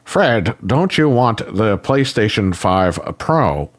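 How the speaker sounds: slow, over-deliberate fake voice; put-on robotic delivery, dragged out slowly; mocking voice-actor impression. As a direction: mimic a stilted game voice actor, word by word. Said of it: "That's what the guy sounds like".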